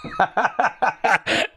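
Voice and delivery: rapid high cackling laugh; burst of laughter. Real, not acted. Streamer laughing at his James impression, high cackle. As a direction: crack up at your own joke.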